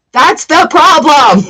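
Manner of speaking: laughing shout that breaks into high giggles. Real, not acted. Tarot reader venting to viewers, bursting out laughing. Mocking friends who dismiss idea.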